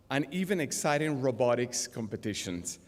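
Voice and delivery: ordinary talk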